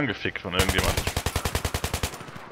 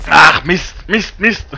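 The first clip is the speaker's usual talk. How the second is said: frustrated; 5 semitones higher, 8 dB louder, much slower than usual. Real, not acted. Missed kill.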